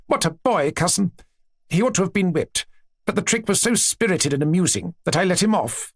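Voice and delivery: a hint of a gushing princess; lightly voiced chatty princess. Princess telling her aunt about her children, lightly gushing.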